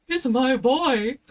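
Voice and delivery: slow, fond voice of a proud dog mum; tender, loving. Retold or imitated. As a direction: melt over the puppy. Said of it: "I was like".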